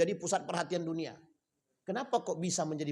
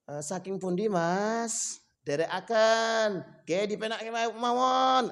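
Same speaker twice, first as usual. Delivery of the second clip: slow Solo speech; 3 semitones higher, slower than usual. Retold or imitated. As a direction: imitate a slow, drawn-out Solo Javanese speaker. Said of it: "pelan-pelan gitu".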